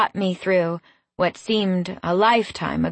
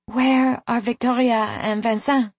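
slightly higher, gently questioning; anxious heroine; 4 semitones higher than usual. A narrator's reading. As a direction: ask with a touch of worry on waking. Weak heroine asks on waking.

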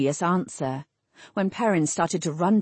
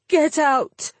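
high, loud dismissal; raised, high-pitched outburst; desperate order; 9 semitones higher, 5 dB louder than usual. A narrator's reading. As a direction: cry out to banish them. Perrin orders them out.

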